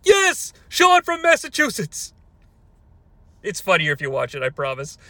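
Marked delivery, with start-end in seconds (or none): 0.0-2.1 much higher, bright host announce; mock cheery host. Host introducing the caller, chirpy and high. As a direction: chirp the name like a cheesy host. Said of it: "And he's like".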